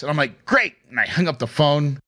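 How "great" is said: quick happy great. Retold or imitated. His quick happy reply.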